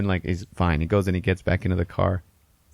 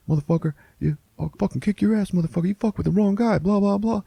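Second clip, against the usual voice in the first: high-pitched, hot-headed imitated rant; mock angry threats; 13 semitones higher than usual. Driver threatening the man who stares, mock angry. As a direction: rattle off threats like a riled-up punk.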